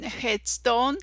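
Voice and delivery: ordinary talk, nothing marked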